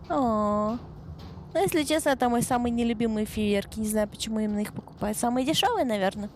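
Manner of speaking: ordinary talk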